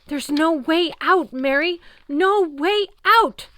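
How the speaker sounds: high, loud, slow despairing cry; panicked wail. A narrator's reading. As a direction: howl with cornered terror. Chris raving, cornered.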